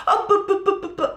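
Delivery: high-pitched mimicry of stumbling speech; mocking stammer. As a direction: make fun of someone tripping over words. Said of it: "starts making fun of me".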